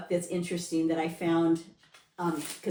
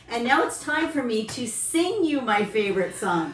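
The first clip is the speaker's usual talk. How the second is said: expressive reading; 6 semitones higher, 6 dB louder than usual. Shown as a demonstration. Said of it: "she's gonna do it".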